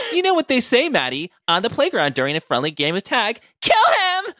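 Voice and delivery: high, giggly playful character voice; Clokey. A narrator's reading. Clokey urges Maddie on in the fight, giggly and high. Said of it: "Clokey said with a giggle".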